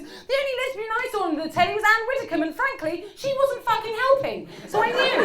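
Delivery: high, loud, worked-up comic outrage; exasperated, high-pitched tirade; indignant rant. Acted. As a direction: rant at full tilt, pitch climbing. Mock-outraged rant about invisible lesbians.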